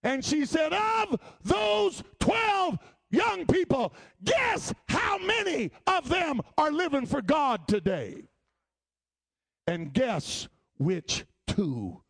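high, loud, slow preaching cry; preaching shout. Retold or imitated. Sister Linville tells the church her story, shouting. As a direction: ring it out to the whole church. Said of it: "Sister Linville said".

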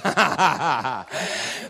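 low, rolling chuckle; hearty laugh. Real, not acted. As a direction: laugh it out from the chest. Preacher laughs after his warning.

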